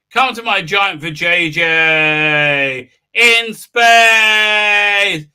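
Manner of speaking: slow, loud, drawn-out beckoning call; hammed-up booming invitation, slowed right down; mock summons. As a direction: ham it up like a cosmic temptress.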